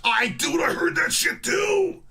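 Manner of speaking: real incredulous shout. Real, not acted. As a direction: belt it out in outrage. Outburst about swan claim.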